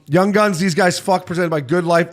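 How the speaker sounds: podcast host plugging the segment; segment announcer read. Acted. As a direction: punch it like a show segment intro. Segment intro read again.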